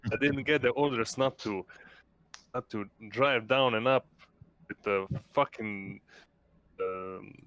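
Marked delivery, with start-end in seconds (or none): none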